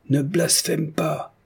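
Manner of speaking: high, drawn-out rebuke; crying out. A narrator's reading. Lout rebukes the widow for blasphemy, crying out. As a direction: cry it out slow and high. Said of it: "cria un butor".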